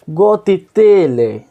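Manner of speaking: गोतीतीले said high, loud and long to mock the name; drawn-out high mock. Shown as a demonstration. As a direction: sing out गोतीतीले high and slow, savouring the odd name. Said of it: "देख रहे हो नाम कैसा है?".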